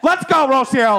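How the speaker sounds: high, loud doctor urging a push; imitated yelling. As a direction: yell like a doctor in delivery. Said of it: "Doctor Dell's yelling beside her".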